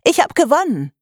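put-on high, loud girlish gloat; triumphant. A narrator's reading. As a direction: crow over the win. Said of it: "rief Vivienne".

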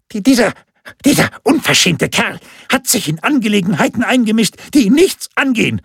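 high, spluttering complaint; outraged, high-pitched official; indignant scolding. A narrator's reading. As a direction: stammer at first, then rant. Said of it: "schimpfte der Amtsdiener".

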